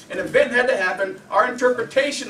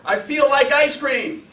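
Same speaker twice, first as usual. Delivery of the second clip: wrong example; 8 semitones higher, 8 dB louder, slower than usual. Shown as a demonstration. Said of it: "No, I feel happy because".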